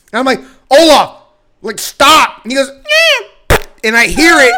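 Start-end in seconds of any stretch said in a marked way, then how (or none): 0.7-2.4 loud, raised-pitch retold command; sharp scolding shout. Retelling catching Olaf humping the floor, scolding shout. 2.7-3.6 short, loud imitated squeak; high-pitched imitated cat noise; high cat grunt. Imitating Olaf as he finishes, high squeal.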